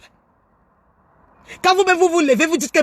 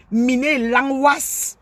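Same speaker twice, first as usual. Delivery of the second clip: each syllable drawn out slowly; much slower than usual. Shown as a demonstration. Showing the audience, very slow.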